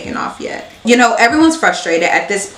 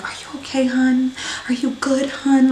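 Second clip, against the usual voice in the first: soft, higher cooing; soothing flight attendant; 5 semitones higher, 5 dB quieter than usual. Retold or imitated. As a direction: gently comfort a crying passenger. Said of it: "flight attendant is like".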